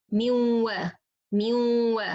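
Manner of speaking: usual speaking voice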